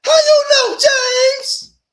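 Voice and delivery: high cartoonish mock shout; mocking squeal. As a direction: squeal it like you're mocking them. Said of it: "And we on there saying".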